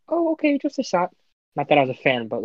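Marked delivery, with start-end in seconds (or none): 0.1-1.1 put-on high, innocent little-boy voice; naive little kid voice. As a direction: play your younger self, naive. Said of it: "little old [name] being like".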